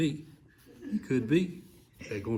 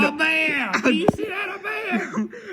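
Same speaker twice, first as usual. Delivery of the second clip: high excited shout; 4 dB louder, slower than usual. Real, not acted. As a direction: yell it out in delight. Excited listener spots a bear.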